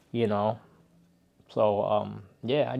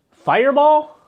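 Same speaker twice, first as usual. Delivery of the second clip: disbelieving squeak, much higher and louder; incredulous; 10 dB louder, slower than usual. Real, not acted. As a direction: react like you can't believe it's there. Finds a bottle he dislikes.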